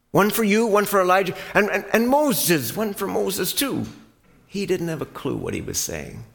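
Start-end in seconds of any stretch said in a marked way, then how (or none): 0.1-3.8 higher, louder, tumbling eager words; eager Peter. Acted. Peter blurting to the master, excited and loud. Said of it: "and he came to the master and he said".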